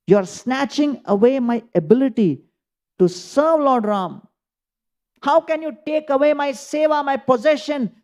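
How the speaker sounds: high, urgent and pleading; impassioned plea. Acted. Bharat pleads with the sage, high and impassioned. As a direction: beg not to lose the seva.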